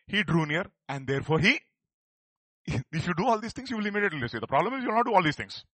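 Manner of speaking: usual speaking voice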